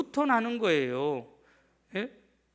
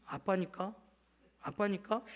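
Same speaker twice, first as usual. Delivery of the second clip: soft, bewildered repeating of the phrase; taken-aback echo; 8 dB quieter than usual. Retold or imitated. Father caught off guard by his child's words, quietly bewildered. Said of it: "제가 좀 당황스러웠어요".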